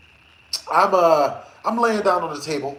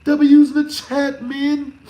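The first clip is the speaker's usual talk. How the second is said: excited; 7 semitones higher, 5 dB louder, slower than usual. Real, not acted. Hyping up a super chat.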